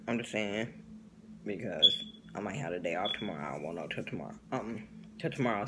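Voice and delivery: low, trailing, tired mumble; very quiet. Real, not acted. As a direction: drop way down, worn out. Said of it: "I'm tired".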